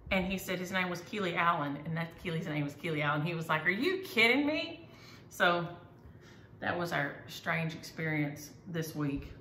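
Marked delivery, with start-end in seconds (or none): 3.6-4.9 high, louder retold disbelief; imitating a stunned stranger's disbelief; astonished. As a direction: pitch it way up in mock astonishment.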